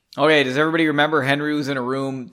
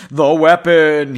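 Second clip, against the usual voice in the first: not calm; 10 dB louder, slower than usual. Acted. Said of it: "he said calmly, unlike the reader".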